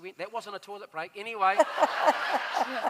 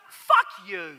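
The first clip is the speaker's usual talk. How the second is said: higher, louder, laughing shout; retold swear punchline; 9 semitones higher, 8 dB louder than usual. Telling the woman what his daughter Grace would say, laughing shout. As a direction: spit it out half-laughing. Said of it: "I said".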